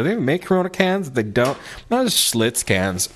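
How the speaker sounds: ordinary talk, nothing marked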